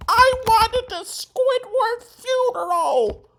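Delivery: put-on very high, slow kid's whining cry; childhood yell. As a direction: wail it like an upset nine-year-old. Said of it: "I remember yelling".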